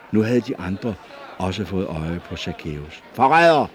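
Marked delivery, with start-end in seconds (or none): 3.1-3.7 a loud accusing shout of traitor; shouting crowd. A narrator's reading. Crowd shouting at the hidden tax collector, loud accusing shout. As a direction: call him out angrily, loudly. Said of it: "råbte flere".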